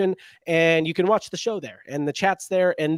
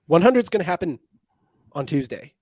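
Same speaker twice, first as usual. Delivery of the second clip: usual speaking voice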